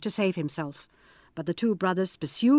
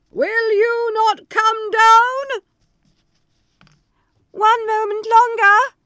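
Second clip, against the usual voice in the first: very high and loud; high loud exchange; 14 semitones higher, 8 dB louder, slower than usual. Acted. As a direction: threaten then plead. Threat then plea.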